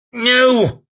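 loud, high bark; shouted no. A narrator's reading. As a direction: cut in sharply. Lawson cuts in.

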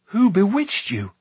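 high, urgent question; aghast cry. A narrator's reading. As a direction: demand it in horror. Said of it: "he cried, aghast".

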